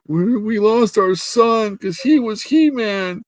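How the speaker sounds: high, slow wailing lament; hammed-up tearful wife; mock crying wife. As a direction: wail it in a drawn-out high voice. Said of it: "his wife's crying".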